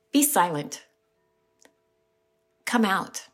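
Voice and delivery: calm, commanding rebuke, set apart; firm holy rebuke. Retold or imitated. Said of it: "This voice says to that unclean demon".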